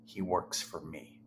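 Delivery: a quiet, unshakable conviction; son's calm claim. Retold or imitated. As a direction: say it quietly, as plain fact. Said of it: "And he said".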